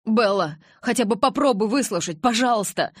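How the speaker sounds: loud, high-pitched begging; urgent, imploring appeal; pleading. A narrator's reading. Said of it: "взмолился Джейкоб".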